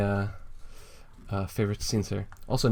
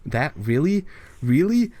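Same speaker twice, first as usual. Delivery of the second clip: rising disbelief, the last Really much higher and louder; incredulous; 6 semitones higher, 5 dB louder, slower than usual. Acted. Sylvester mocking a friend, rising in disbelief. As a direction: climb from a doubtful That to a shrieking Really.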